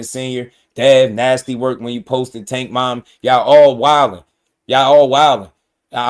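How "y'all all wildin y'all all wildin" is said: loud, heated exclamation; fed-up loud outburst; exasperated call-out. Real, not acted. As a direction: throw it out loud in disbelief. Heated rant at boxers.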